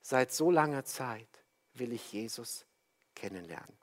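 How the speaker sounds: quiet and serious. Retold or imitated. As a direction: say it quietly and earnestly, like a secret longing. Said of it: "wurde dieser Geschäftsmann ganz ruhig und ernst".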